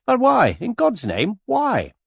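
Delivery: high, loud disbelieving demand; outraged plea. Acted. George to Virginia, high and loud outrage.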